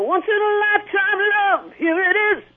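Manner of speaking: high, loud power-ballad belt; big adult contemporary belt. Shown as a demonstration. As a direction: belt it like an eighties love ballad. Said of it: "in that kind of voice".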